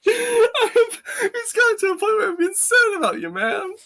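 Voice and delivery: very high, squeaking through laughter; real laughing fit. Real, not acted. Friend voicing concern about him, helpless high laughter. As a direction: squeeze the words out while cracking up.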